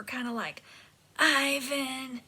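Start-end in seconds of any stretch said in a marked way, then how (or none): 1.2-2.2 breathy, rasping call of a name; hoarse whisper. Shown as a demonstration. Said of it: "kinda sounding like a hoarse whisper".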